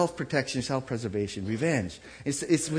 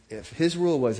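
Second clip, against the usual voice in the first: usual speaking voice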